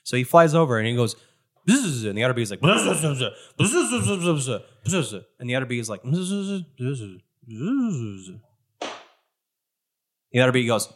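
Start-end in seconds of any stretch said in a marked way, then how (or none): 1.7-2.1 higher buzzed bee talk; bee buzzing. Acted. Game master as bee addressing other bee, buzzing. 2.6-5.2 rising and falling buzz chatter; bee buzzing. Acted. Game master as other bee answering, chattering buzz. 6.0-8.9 bee buzzing. Acted. Game master as bee persuading the other, buzzing. Bees argue in buzzes.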